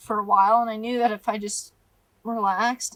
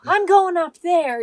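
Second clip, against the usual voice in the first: higher, louder decisive declaration; determined; 8 semitones higher, 7 dB louder than usual. Retold or imitated. To his wavering partner while packing up, a decisive declaration. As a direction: announce it, done arguing. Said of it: "He's like".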